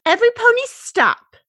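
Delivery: high, loud stop order; commanding shout. Acted. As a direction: halt them all firmly. Fluttershy commanding her friends.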